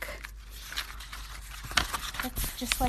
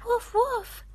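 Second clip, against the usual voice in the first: puppy barks; 13 semitones higher than usual. Acted. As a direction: yip like a little puppy. Mom voices the puppy.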